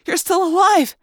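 high, relieved female voice; relieved woman. A narrator's reading. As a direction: breathe out relief. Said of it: "she said".

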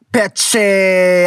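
normal talking voice

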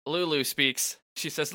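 normal talking voice

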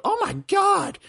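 high, loud mock shriek; shocked older ladies. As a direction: imitate scandalized ladies crying out in shock. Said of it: "I hear them go".

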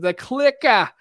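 high, showy Boston "clicka" for CLICKER; Boston accent. Shown as a demonstration. Showing a friend his Boston accent, CLICKER as "clicka". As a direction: punch CLICKER with a dropped Boston R. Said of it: "that's my Boston accent".

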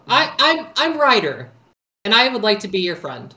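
in-character introduction. Acted. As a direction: project it to a big creature. Player introduces himself to the monster.

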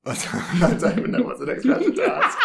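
giggling, can barely get the question out; laughing. Real, not acted. Host giggling while asking the next question, laughing.

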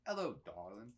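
a light, quiet Southern lilt; Southern accent. Shown as a demonstration. Co-host trying out another accent, light Southern accent. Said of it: "That's more Southern".

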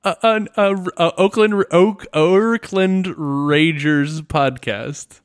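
stumbling hype announcer; mock show host. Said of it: "I had to stop myself".

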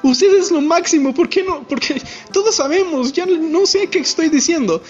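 mock admiring schoolboy; fawning young guy. Young guy to the president, lightly acted fawning praise.

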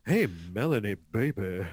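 low, slightly hushed come-on; shady sweet-talker. Acted. Suitor leaning in to Melanie, low and sleazy. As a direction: lean in and croon it low. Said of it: "They're all leaning in".

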